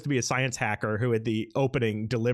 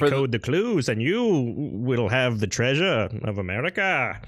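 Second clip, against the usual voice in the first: grand colonial proclamation; town crier; 3 dB louder than usual. Acted. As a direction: declaim like a herald. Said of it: "he is dressed as Ben Franklin".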